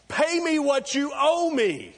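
high, loud, slow demand for money; angry demand. Acted. Forgiven servant throttling a debtor, slow high angry demand. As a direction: growl out the demand while choking him. Servant choking his fellow servant.

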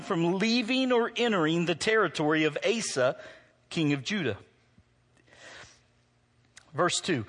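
formal scripture reading. Acted. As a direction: read the passage out to the church. Reads scripture aloud.

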